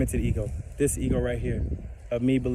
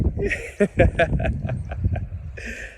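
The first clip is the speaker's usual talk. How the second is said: airy, silent laugh; breathy laugh; 3 semitones higher than usual. Real, not acted. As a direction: laugh under your breath at the joke. Laughs at friend's line.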